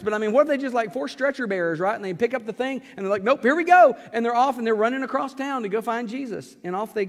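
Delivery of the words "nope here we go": loud, high and gung-ho; eager shout. Retold or imitated. Friends hoisting the stretcher, shouting gleefully. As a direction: cheer like you're hauling him off. Friends grab paralyzed man anyway.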